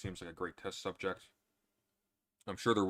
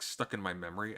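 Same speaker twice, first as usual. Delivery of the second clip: ordinary delivery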